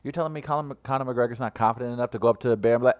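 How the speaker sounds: ordinary delivery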